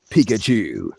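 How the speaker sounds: usual speaking voice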